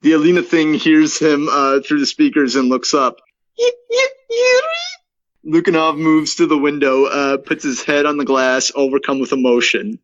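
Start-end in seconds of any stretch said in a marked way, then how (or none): 3.6-5.6 very high, crying falsetto; stuttering high sobbing call; tearful wife's call. Acted. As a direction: call his name like a lost, crying wife. Creature calls out in wife's voice.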